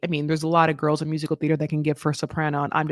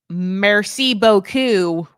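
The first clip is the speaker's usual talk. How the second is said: put-on drawn-out French in a thick American accent; loud, slow American-accented French with hard R's; hardest American accent; 2 semitones higher, 6 dB louder, much slower than usual. American learner murdering French for comic relief, thick American accent. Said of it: "like, the hardest American accent".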